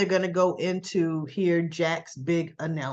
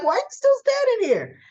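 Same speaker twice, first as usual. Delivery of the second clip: mocking high voice; 5 dB louder than usual. Mocking Danny, after a laugh.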